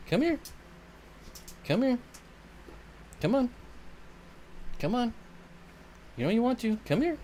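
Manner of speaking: high, soft you're so needy cooed at the dog. Real, not acted. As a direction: coo at the needy puppy. Calling pet.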